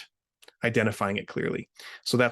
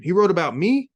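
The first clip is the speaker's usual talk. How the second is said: high, loud astonished question; surprised; 8 semitones higher, 7 dB louder than usual. Acted. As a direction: ask in disbelief. Like an Ocean shocked.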